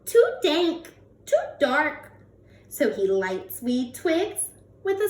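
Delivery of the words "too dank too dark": high squeaky little mouse voice. Acted. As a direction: squeak it like a tiny fussy mouse. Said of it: "Mouse squeaks".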